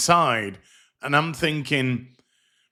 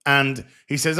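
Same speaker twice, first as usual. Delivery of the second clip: ordinary delivery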